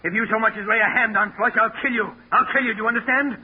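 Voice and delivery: high, loud, furious warning; shouting a death threat at his wife. Acted. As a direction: threaten her with rage. Husband threatens wife over dog.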